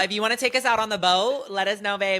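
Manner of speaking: high, loud mock invitation; playful calling out. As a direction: call out to a celebrity, half joking. Playful shout-out to absent celebrity.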